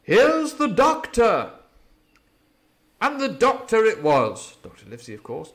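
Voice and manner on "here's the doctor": loud, high, slow hail; hearty call. A narrator's reading. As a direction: call out cheerily from the wood. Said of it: "it cried".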